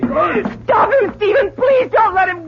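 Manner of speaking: desperate begging shout; frantic plea to her husband; tearful shout. Acted. Mother begs husband to stop son.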